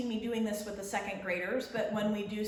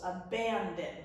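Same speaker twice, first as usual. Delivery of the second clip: abandon with BAN more forceful, falling away; stress on middle syllable; 3 semitones lower than usual. Shown as a demonstration. Teacher modelling the accent, middle syllable of abandon stressed. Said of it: "a little more forceful than we say the other syllables".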